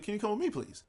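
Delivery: lightly voiced friendly clerk; sweet polite girl. Young clerk politely asking the thief to follow, mild sweet voice. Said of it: "it's just the way she said it".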